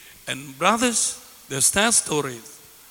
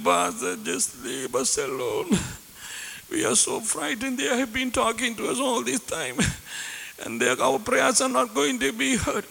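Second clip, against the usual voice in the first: put-on high, soft, scared little child voice; imitating a frightened elderly man who sounds like a child; frightened ten-year-old kid; 6 semitones higher, 4 dB quieter than usual. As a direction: plead like a terrified small boy, higher and softer. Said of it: "I will just imitate".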